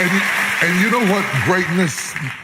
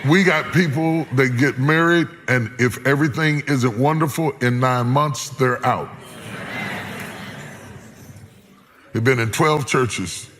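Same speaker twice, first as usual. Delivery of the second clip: raised preaching rebuke. Acted. Preacher calling out church-hoppers, raised preaching voice. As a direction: lift it up and call them out.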